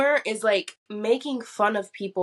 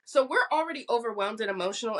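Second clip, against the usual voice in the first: usual speaking voice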